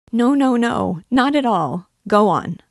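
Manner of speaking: high, loud, hurried "no, no, no"; a girl's loud, high, eager plea; eager protest. Acted. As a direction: cut him off, begging him to go on. Nastenka hastily protests, begging him continue.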